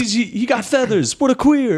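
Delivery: mock the feathered one; high mocking dinosaur voice. Dinosaurs insulting each other.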